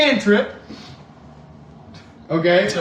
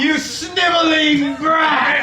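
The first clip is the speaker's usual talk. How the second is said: villain sneer; 7 semitones higher, slower than usual. Acted. Villain insults the crew.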